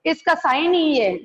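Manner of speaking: usual speaking voice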